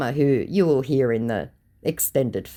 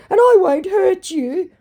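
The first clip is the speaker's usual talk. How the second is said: high, loud put-on pleading; mock pathetic; 7 dB louder than usual. Scammer begging the host after goodbye, mock pathetic. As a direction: overplay a pitiful plea. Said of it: "four very pathetic sad emojis".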